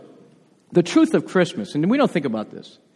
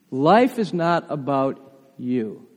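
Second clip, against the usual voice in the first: slow emphasis; 4 semitones lower, 4 dB quieter, slower than usual. Shown as a demonstration. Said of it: "Watch this".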